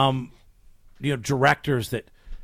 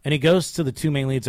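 normal talking voice

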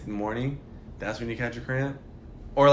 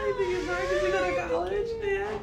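mock whine; 5 dB quieter than usual. Teasing sister who goes to college.

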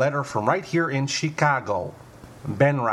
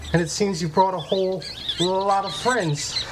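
hammed-up rising terror, voice climbing higher; mock panic; 7 semitones higher than usual. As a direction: act out being swarmed by mice, shrieking higher each line. Comic mailbag skit, rising panic.

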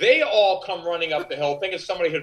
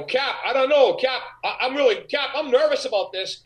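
higher, pleading fake jitters to the captain; pretending to be scared before throwing the dummy, higher; mock nervous plea; 8 semitones higher than usual. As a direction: ham up being scared to set up the prank. Faking fear before dummy prank.

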